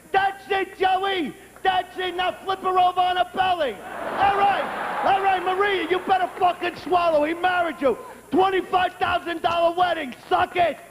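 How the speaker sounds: mock cheerleader yelling, pitched high; cheerleader shouting. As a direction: cheer the couple on like a crazed fan. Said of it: "I became like that cheerleader".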